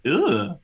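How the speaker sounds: short pained grunt; a quick dying ugh; mock grunt. Retelling a movie death, mock dying grunt. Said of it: "somebody else just went".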